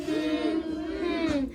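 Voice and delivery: hummed syllables, mouth closed; humming the word. Shown as a demonstration. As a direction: hum the word's beats softly. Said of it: "Hum it".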